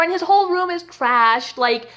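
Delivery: usual speaking voice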